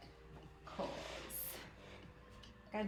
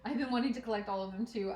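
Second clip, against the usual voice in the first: ordinary delivery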